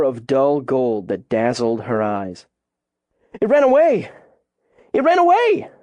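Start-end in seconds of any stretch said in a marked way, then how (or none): 3.4-5.7 very high, louder excited cry; fearful shout. A narrator's reading. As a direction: yell it out, voice leaping with fear. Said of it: "Coop shouted, his voice raw with fear".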